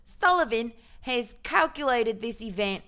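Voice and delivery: badly faked British-Australian accent; half Australian, half British. Streamer reading the astronomy article, half Australian, half British accent. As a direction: read it in a muddled British accent. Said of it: "half Australian, half British".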